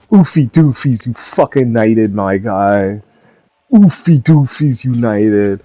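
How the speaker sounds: normal talking voice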